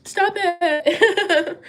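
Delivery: high tearful protest, laughing; overwhelmed, half laughing and half crying; emotional. Real, not acted. Reader flustered by a viewer's kindness, tearful. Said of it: "I'm so emotional".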